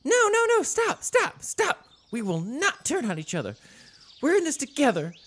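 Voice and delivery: very high, squeaky pleading rabbit; frantic rabbit. Acted. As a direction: plead for peace in a tiny squeak. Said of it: "the rabbit goes".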